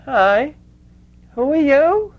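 loud, high impression of an old Brooklyn woman; Brooklyn lispy voice. Lena greets Mother Teresa from her bench, loud Brooklyn lispy voice. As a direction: pitch it way up and loud, like a Brooklyn character. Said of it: "in this very thick Brooklyn lispy voice".

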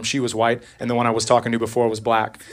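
ordinary talk, nothing marked

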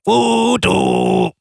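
high mock Voodoo as "udu"; imitating the singer's Voodoo. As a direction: parody the vocalist's Voodoo up high. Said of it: "kun tuo lausuta voodoo".

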